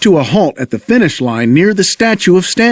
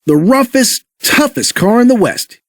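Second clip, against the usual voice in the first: high, proud declaration; proudly; 7 semitones higher than usual. A narrator's reading. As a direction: boast on the hero's behalf. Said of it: "he said proudly".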